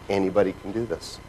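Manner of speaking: soft, low repeat with the stress on do; quiet. Shown as a demonstration. Repeating it softly so it sinks in. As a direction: drop it to a near hush. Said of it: "I cannot emphasize that enough".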